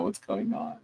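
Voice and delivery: a lost, hushed whisper of confusion; bewildered whisper. Retold or imitated. Retelling her panic after the sermon, whispered. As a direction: breathe it out, barely voiced. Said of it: "I'm like".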